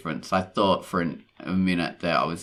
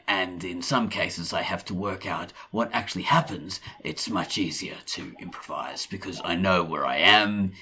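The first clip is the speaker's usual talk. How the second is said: threatening Australian. Acted. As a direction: read it as a menacing Australian. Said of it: "my more threatening Australian voice".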